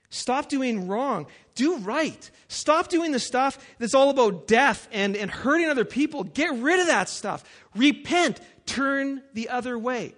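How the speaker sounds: loud, high, slowed commands; urgent preaching. Acted. Preacher urging the congregation to repent, loud and high. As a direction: press the call to repent hard. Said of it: "Notice the change in these verses".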